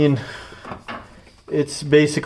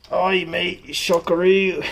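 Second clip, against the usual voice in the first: put-on a light, jokey Australian accent, pitched up; mock Australian accent; 5 semitones higher, slower than usual. Joking to viewers about shock names, in a hammed-up Australian accent. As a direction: toss it off like a cheeky Aussie bloke. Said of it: "really Australian".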